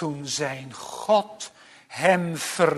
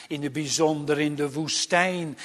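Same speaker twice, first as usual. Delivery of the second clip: usual speaking voice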